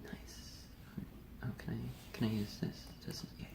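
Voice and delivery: soft hushed aside; whispered. Real, not acted. Co-host quietly asks to use a prop, whispering. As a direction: keep it barely voiced.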